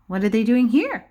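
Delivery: normal talking voice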